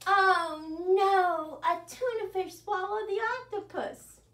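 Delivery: high, loud mock-alarmed oh no; dramatic oh no. Shown as a demonstration. Librarian acts shocked for children in the song, high and loud. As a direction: gasp it out in playful alarm for the kids. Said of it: "the part where we go, "Oh no".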